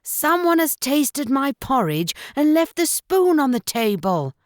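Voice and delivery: ordinary delivery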